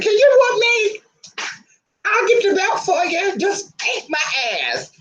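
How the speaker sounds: put-on high squeaky begging child voice; pleading mock child. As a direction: beg like a kid for the belt. Begging for a whooping instead.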